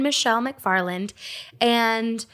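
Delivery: usual speaking voice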